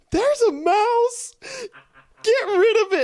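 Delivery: hammed-up mom screaming about a mouse; panicked mom. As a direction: shriek like a terrified mother. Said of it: "She's like".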